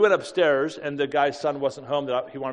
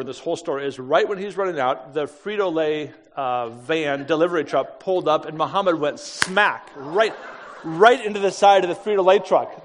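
normal talking voice

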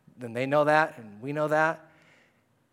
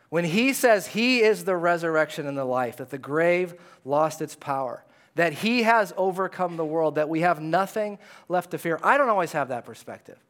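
ordinary talk, nothing marked

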